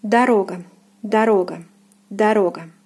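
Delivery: "дорога" said da-RO-ga, stress on the second syllable; "дорога" in separate model takes; Russian pronunciation. Shown as a demonstration. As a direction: say "дорога" firmly, first O as a, second as full O. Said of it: "will be pronounced as a".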